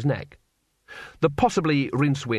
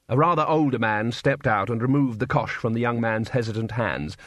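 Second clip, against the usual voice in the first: plain reading voice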